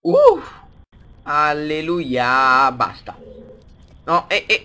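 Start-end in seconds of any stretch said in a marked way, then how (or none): 0.0-0.4 high yelp. Real, not acted. Sudden yelp at the game.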